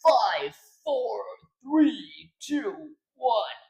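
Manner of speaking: high, spaced-out announcer count; game countdown. Shown as a demonstration. Streamer auditioning a game countdown, high announcer count. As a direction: count down in a high voice, one beat each. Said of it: "I'm gonna go with something like".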